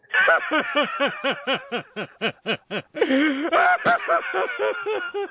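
long, high cackling laugh; helpless laughing fit. Real, not acted. Host cracking up at his own stupid joke, helpless laughter.

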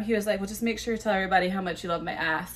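ordinary talk, nothing marked

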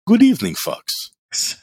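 sweetly polite greeting with an insult; mock heavy-accented waiter greeting; imitating Dino's accent. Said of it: "He had such a heavy, heavy accent".

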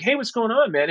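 high, bright call of hello; cheery greeting. Retold or imitated. Greeting Drew as he walks up at the retreat, high and cheery. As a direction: greet a new friend with a lift.

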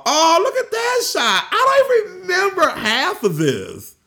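high-pitched squeal of delight; amazed excitement. Real, not acted. Vlogger watching own footage, amazed excitement. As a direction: gush in a very high voice.

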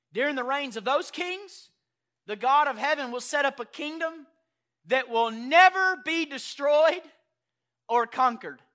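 loud, high proclaimed verse; booming scripture reading. Acted. Pastor booming Daniel's prophecy to the church.

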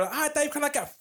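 high, eager fan voice. Retold or imitated. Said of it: "everyone would be like".

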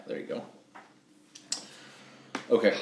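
plain reading voice

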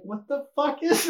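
high, drawn-out disbelief; incredulous. Retold or imitated. Storyteller crawling under stacked desks on stairs, incredulous. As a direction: stretch the question out high, baffled.

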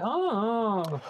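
high, whiny mock "Oh". Mother annoyed by her son's question, high whine. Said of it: "in a very whiny".

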